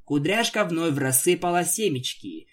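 usual speaking voice

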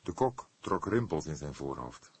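plain reading voice

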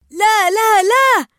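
high desperate shout of no; calling out no. Acted. Marlin calls Dory back from the sharks, shouting. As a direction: yell after her to stop. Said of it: "نادى مارلين عليها قائلًا".

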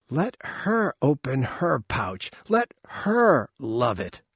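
slow, scornful emphasis on each her; contemptuous. A narrator's reading. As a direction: drag the words out with contempt. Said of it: "Ma'am turned contemptuously to Valen".

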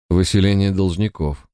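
ordinary delivery, nothing marked

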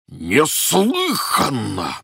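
furious slow roar. Acted. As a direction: bellow it, drawn out. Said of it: "проревел Пётр Иванович".

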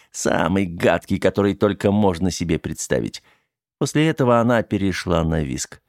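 plain reading voice

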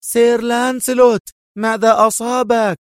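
high, pretended cry of alarm; calling out. A narrator's reading. Adham feigning concern for club guests, calling out. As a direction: cry out so the whole room hears. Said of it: "يهتف بصوت مرتفع".

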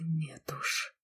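unvoiced close whisper. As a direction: breathe it into her hair. Lan holds her close.

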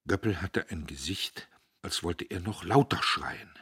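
ordinary delivery

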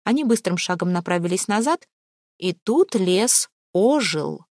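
plain reading voice

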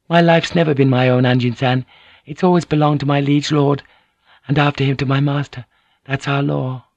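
lifted register, formally composed; composed, dutiful lady. Acted. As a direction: voice a lady explaining her duty. Lady explains her duty.

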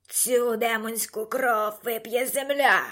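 slow, hoarse villain's proclamation; Darth Vader. A narrator's reading. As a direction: rasp out a drawn-out, menacing vow. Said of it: "прохрипів Дарт Вейдер". (хрипкий повільний голос лиходія)

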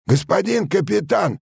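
machinist's angry high-pitched yell; strong, spiteful shout. A narrator's reading. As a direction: shout up to the captain, full of spite. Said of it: "крикнул машинист своим глухим, но сильным и злобным голосом". (злобный, сильный крик машиниста)

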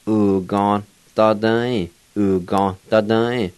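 "U, ga, da, da, e" blended, ga closing on N as "gon"; connected word, "ga" said gon before "da"; silent V demo. Shown as a demonstration. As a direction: drop the vowel of na, leaving "ga" as gon. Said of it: "You pronounce it like this".

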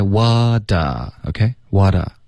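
WHAT A slowly, as a wide "wah-da"; open vowel. Shown as a demonstration. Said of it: "嘴巴张开". (示范：what a 张大嘴巴慢读)

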